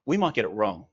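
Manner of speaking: ordinary talk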